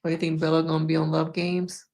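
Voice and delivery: ordinary delivery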